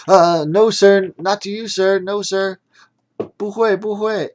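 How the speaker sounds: high, breathless pleading; gasping, frightened. Acted. Sunny Day pleading with Mister Magic, high frightened stammer. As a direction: stammer it out, scared and short of air. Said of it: "while gasping for air".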